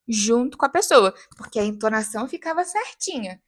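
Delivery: ordinary talk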